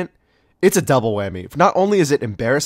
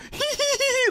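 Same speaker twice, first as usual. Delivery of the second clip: very high-pitched imitated giggle; mocking laugh; 4 dB quieter than usual. Homie laughing in the background at the presenter, mocking giggle. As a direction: squeal a mocking "hee-hee-hee". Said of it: "laughing in the background".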